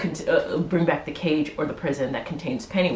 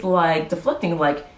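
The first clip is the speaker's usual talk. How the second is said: ordinary talk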